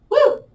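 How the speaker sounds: high-pitched "woo" of effort; high whoop. Real, not acted. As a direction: let out a quick, high whoop. Exhales whoop during hard pose.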